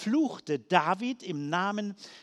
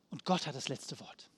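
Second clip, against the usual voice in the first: softer, lower repeat with stress on Gott; quiet affirmation; 6 semitones lower, 6 dB quieter than usual. Real, not acted. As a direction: let the line settle low and quiet. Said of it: "Christus hat das letzte Wort". (leise, tief nachklingend)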